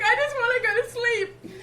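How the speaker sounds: very high, loud, half sobbing half laughing; laugh-crying whine. Retold or imitated. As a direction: wail it through tears and laughter. Mock insult at midwife.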